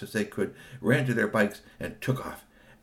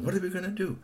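panicked repeating; 11 semitones higher, faster than usual. Acted. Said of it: "they were saying".

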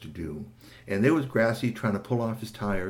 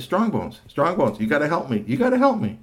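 higher, louder pleading call; urgent plea; 5 semitones higher, 4 dB louder than usual. Acted. Marvel calling Strongbones for help, urgent and higher.

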